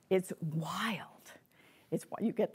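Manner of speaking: half-whispered wonder; softly marveling, nearly whispered; hushed amazement. Real, not acted. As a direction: drop to a soft, breath-only whisper in awe. Marvels confidingly at the trick.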